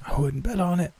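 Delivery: high, sickly rasp; scratchy voice. A narrator's reading. Sick roommate answering the teasing, scratchy. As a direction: retort through a sore throat. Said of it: "her voice so scratchy it was painful".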